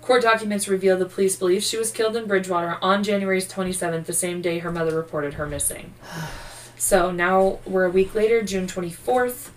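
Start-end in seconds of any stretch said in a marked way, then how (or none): none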